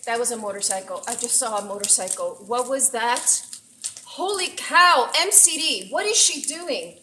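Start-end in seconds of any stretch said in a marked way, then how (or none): 4.0-7.0 high, loud burst of real amazement; amazed excitement. Real, not acted. Streamer reacting to her friend's surprise raid, thrilled and loud. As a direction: blurt it out, thrilled and stunned.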